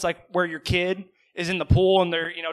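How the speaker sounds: usual speaking voice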